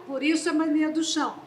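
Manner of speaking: ordinary talk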